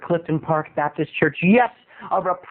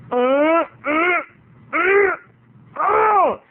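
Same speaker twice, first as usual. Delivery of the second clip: exaggerated yummy hums; 8 semitones higher, much slower than usual. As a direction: hum with over-the-top tasting pleasure. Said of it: "she's like".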